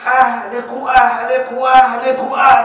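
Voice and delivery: mock nagging at the wife's family, slow and loud; mock scolding. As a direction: hammer her family over and over. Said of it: "متوقعة يطلعوا من هون ونبلش".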